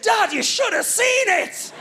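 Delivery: high, drawn-out excited squeal; thrilled child. Retold or imitated. Little son reporting to dad, thrilled. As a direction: marvel like an awestruck toddler, pitched high. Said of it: "His face said".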